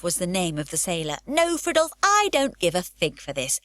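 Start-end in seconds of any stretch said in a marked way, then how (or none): 1.2-3.6 put-on high, loud burst of childish defiance; cheeky refusal. A narrator's reading. Pippi dismissing her sailor teacher, high and loud. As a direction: brush him off like a bored child.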